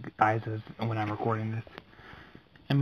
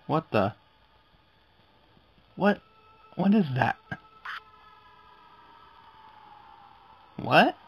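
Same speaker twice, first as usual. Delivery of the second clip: startled; 4 semitones higher than usual. Real, not acted. Scary cut reaction.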